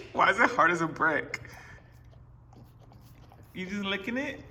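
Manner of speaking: high, soft pet voice. Real, not acted. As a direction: coo at the puppy. Talking to the dog.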